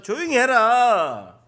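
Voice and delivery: loud, drawn-out rebuke; scolding shout. Acted. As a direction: hush him loudly, dragging the words out. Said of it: "꾸짖어서".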